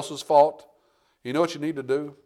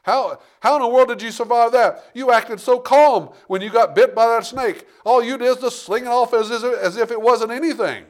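amazed onlookers; 8 semitones higher, 5 dB louder than usual. Retold or imitated. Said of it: "they were sitting there saying".

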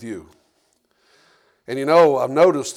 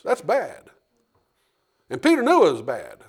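normal talking voice

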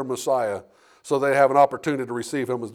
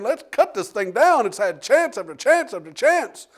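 higher, louder and slow, fed up with the barren tree; exasperated owner; 7 semitones higher, 3 dB louder than usual. Acted. Vineyard owner demanding the tree be cut down, exasperated and loud. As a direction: lean on each chance as if patience is gone.

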